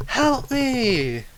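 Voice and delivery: high, small voice calling for help; a caged child pleading high; caged girl begging, high; little girl crying out. Acted. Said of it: "this little girl goes".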